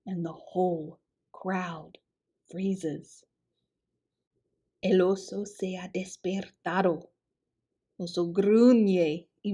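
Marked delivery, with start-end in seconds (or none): 0.1-3.2 softer, held-breath storyteller hush. A narrator's reading. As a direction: let it drop to a hush. Crowd freezes.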